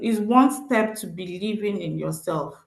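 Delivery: normal talking voice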